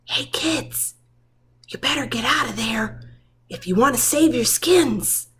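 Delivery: urgent whisper, fast in the middle. Acted. Tibbs warning the puppies through the window, whispering. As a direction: hush it so the villains can't hear.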